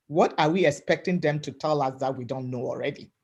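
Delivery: ordinary delivery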